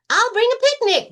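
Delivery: very high, loud eager bunny voice; excited Rabbit. Acted. Rabbit offering a picnic to Lion, very high and loud. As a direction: squeal it out happily.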